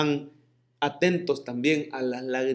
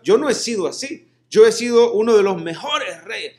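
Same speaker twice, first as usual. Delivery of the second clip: higher, louder, insistent mock demand; demanding prayer; 5 semitones higher, 5 dB louder than usual. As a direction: play a self-righteous king demanding healing. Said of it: "Él no fue reclamando". (Ezequías imaginado exigiendo a Dios con arrogancia)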